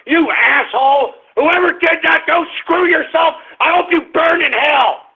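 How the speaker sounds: high-pitched yelling tirade; angry rant. Real, not acted. Host to whoever faked the Trump tweet, angry yelling. Rages at fake tweet's author.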